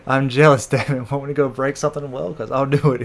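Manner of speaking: half-joking hot-headed threat; mock jealous outburst. Sanji threatens the castaway over Nami, mock jealous shouting.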